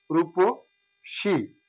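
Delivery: রূপসী said very slowly as রুপোশি; drawn out রূপসী with short u and শ; slow model pronunciation. Shown as a demonstration. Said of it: "সঠিক উচ্চারণ রূপসী". (ধীরে উচ্চারণ)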